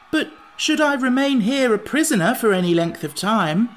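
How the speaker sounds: high, loud, worried protest. A narrator's reading. Jack objects to staying a prisoner, protesting. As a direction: object with rising alarm. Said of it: "protested Jack".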